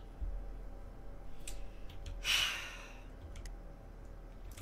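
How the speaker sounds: long, soft exhale of defeat; long sigh. Real, not acted. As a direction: let out a drawn-out sigh. Defeated after jump scare.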